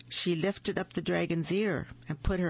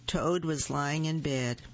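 ordinary delivery

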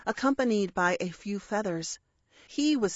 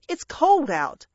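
higher, louder grumble; complaining parrot; 6 semitones higher, 5 dB louder than usual. Acted. Parrot to the intruder at the door, cross complaint. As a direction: complain crossly about the cold.

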